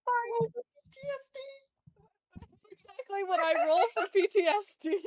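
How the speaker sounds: high, gleeful exclamation; excited outburst. Real, not acted. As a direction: burst out delighted and laughing at the joke. Player delighted by GM's roll call.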